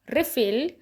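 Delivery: Refill with REE stressed; refill: first syllable hit; noun stress, first syllable. Shown as a demonstration. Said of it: "तो difference देखो".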